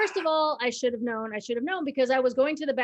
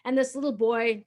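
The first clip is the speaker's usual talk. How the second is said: ordinary delivery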